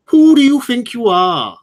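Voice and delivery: high, loud, drawn-out Welsh attempt; attempted Welsh accent. Podcast host embarrassing herself for the chat box, attempted Welsh accent. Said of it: "I can't do a Welsh accent at all".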